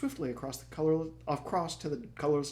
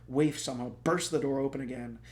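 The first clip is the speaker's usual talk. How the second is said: usual speaking voice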